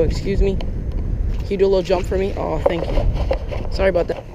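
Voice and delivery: normal talking voice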